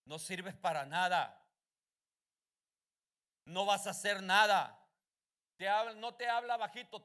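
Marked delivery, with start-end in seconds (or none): 0.0-4.8 lightly voiced devil, slow and firm; a hint of an accusing devil; devil speaking with authority. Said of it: "te habla con voz clara y con autoridad". (diablo con voz clara y autoritaria, apenas actuado)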